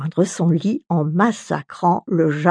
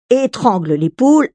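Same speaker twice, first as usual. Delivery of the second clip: ordinary delivery, nothing marked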